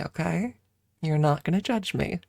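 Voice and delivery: ordinary talk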